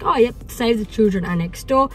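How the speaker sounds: ordinary talk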